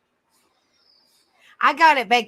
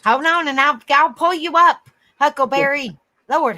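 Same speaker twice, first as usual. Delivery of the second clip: louder, higher call to hurry; 3 semitones higher, 8 dB louder than usual. Real, not acted. Telling the impatient dog to wait, a loud raised call. Rushing to answer eager dog.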